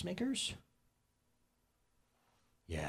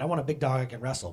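ordinary talk

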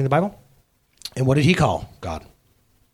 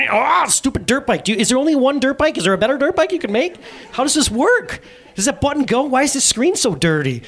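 high-pitched exasperated griping at a game; comic exasperated rant, pitched up; mock frustration; 8 semitones higher than usual. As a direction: rattle off complaints, hammed-up and rising. Dad acting out frustration at game.

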